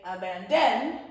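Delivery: abandon with DON punched loud and lifted; stress on last syllable. Shown as a demonstration. Teacher showing pupils the wrong way, last syllable of abandon stressed. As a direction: hit the final syllable of abandon hard. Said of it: "We put the emphasis on the middle syllable".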